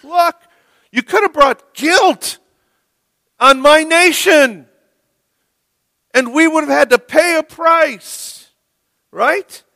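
high, loud angry rebuke; angry king. Retold or imitated. Philistine king rebuking Isaac for his lie, angry and loud. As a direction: scold him like a furious king. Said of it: "this Philistine king is angry".

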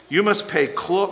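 normal talking voice

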